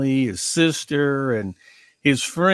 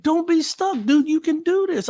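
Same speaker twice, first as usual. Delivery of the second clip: much higher, pleading pep talk; urgent encouragement; 11 semitones higher than usual. Retold or imitated. Speaker urging a stuck Mike, high and pleading. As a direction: lift him up, urging hard. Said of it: "And I said".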